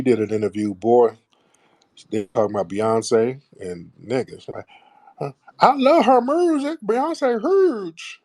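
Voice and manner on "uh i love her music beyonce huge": high-pitched, exaggerated Memphis accent. Mocking a rapper's interview about [name], in an exaggerated Memphis accent. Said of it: "that was just beyond an accent".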